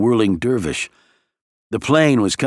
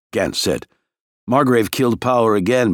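ordinary delivery, nothing marked

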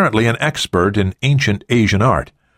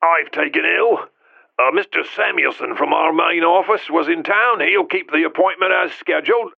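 high, croaky, throat-clearing sick voice; croaking voice; 9 semitones higher than usual. A narrator's reading. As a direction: croak it out like a sick old man. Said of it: "the voice sputtered".

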